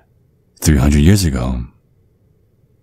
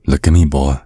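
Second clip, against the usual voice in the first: ordinary delivery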